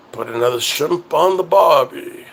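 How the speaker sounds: put-on a tourist trying an Aussie accent and missing; a loose Australian accent that barely lands; attempted Australian accent. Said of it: "that didn't work out either".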